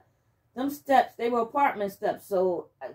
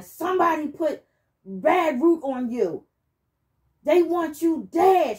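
louder, higher, slowed warning in a mock shout; retold shouting; 7 semitones higher, 4 dB louder than usual. Healer's daughter relaying the warning, mock shouting. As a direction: hammer out the curse like the fussing healer. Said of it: "She kept shouting".

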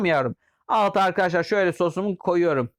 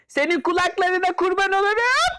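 much higher, doting squeaky voice; gushing put-on voice; 9 semitones higher than usual. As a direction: coo over him like a doting aunt. Gushing at a customer. (yüksek perdeden sevgi dolu taklit ses)

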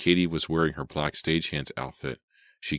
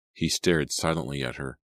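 usual speaking voice

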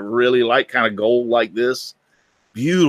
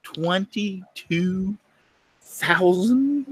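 slow, high, drawn-out "Twenty-two thousand?"; stunned disbelief; 8 semitones higher, 4 dB quieter, much slower than usual. Retold or imitated. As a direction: repeat the price as if you misheard it. Shocked at the watch price.

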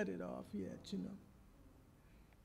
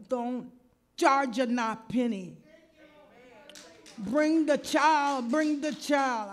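builds from slow and earnest to a high, loud, slow command; rising to a prophetic shout, slow and high; building proclamation; 4 semitones higher, 11 dB louder than usual. Retold or imitated. Caller from child care relays God's message, building to a high, slow, loud command. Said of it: "She said, "I had a dream".

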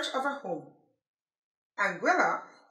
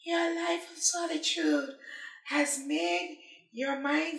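kind lizard voice; 3 semitones higher, 3 dB quieter than usual. Acted. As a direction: soothe him in the raspy lizard voice. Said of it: "responded with great kindness".

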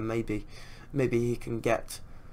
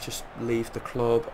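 ordinary talk, nothing marked

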